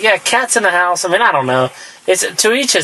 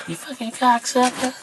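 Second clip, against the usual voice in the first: soft, high mock Georgia accent; badly faked impression slipping into Georgia; 7 semitones higher, 8 dB quieter than usual. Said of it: "with, like, a Georgia accent".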